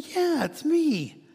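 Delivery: high, loud and slow with delight; delighted answer. Acted. Healed man answering the neighbors, delighted and high. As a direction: stretch it out gleefully, way up high.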